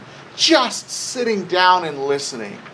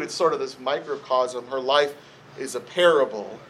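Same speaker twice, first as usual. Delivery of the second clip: normal talking voice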